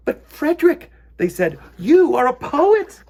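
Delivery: very high, loud squeal of delight; excited mice. Acted. Mice cheering Frederick after his poem, high excited squeal. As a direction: burst out in amazed praise.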